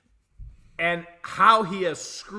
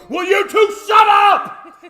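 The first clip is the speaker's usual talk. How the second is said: much higher, loud exasperated shout; 17 dB louder, slower than usual. Retold or imitated. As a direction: yell it at them, fed up. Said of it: "I literally just wanna go".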